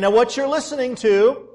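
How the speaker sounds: loud, preaching out over a big crowd; booming voice. Retold or imitated. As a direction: boom it out so the whole crowd hears. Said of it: "in his booming voice".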